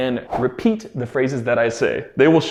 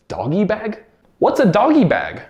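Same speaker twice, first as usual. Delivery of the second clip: puzzled question; 6 semitones higher than usual. Pretend. Feigning a puzzled student.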